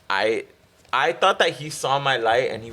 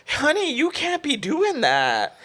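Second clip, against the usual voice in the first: country lady; slower than usual. Retold or imitated. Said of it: "like, country lady".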